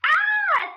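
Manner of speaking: very high loud scream; mock terrified shriek. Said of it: "said Little Red".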